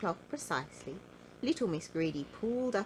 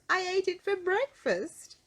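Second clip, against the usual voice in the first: chuckling confession; 13 semitones higher, 5 dB louder than usual. Acted. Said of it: "she chuckled again".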